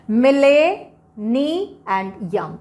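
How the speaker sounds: loud rising "मिले", then slow "नी and यम"; slow, emphatic chunks "मिले, नी and यम"; syllable breakdown. Shown as a demonstration. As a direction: punch "मिले", pause, then "नी and यम" in pieces. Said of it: "तो यहाँ पे हम क्या लिखेंगे".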